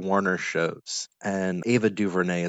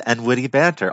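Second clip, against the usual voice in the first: excited discovery; 4 semitones higher, 7 dB louder than usual. Real, not acted. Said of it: "Ah, that's it".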